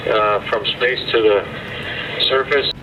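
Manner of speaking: distant radio voice from orbit; astronaut radio. Real, not acted. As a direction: talk through a radio link. Astronaut answers over radio link.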